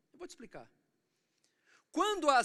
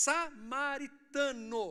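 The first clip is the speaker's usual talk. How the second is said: "samaritano" broken into slow, raised syllables; stress on samaritano; 5 semitones higher, 4 dB louder, much slower than usual. Acted. Preacher correcting the guesses, "samaritano" slow and emphatic. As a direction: spell out "samaritano" syllable by syllable, drawn out. Stresses the key word, contrasting nationalities.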